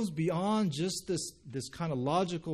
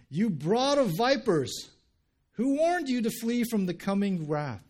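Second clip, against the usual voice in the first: louder, higher preacher's shout; fierce rebuke; 8 semitones higher, 6 dB louder than usual. Acted. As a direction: thunder it at the crowd in rebuke. Preacher voices John's fierce rebuke to crowds.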